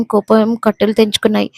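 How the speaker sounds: plain reading voice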